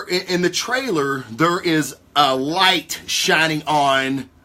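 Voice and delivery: ordinary talk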